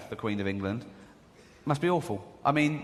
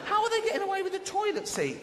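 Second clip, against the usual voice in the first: high, incredulous demand; hammed-up bafflement; comic outrage; 14 semitones higher than usual. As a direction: voice shoots up in exasperation. Comic outrage at stolen seat.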